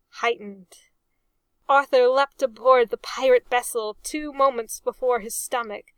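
plain reading voice

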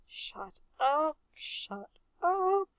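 rising, pleading moan; embarrassed moan. A narrator's reading. Reg begging sniggering pirates to stop, moaning. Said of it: "Reg moaned".

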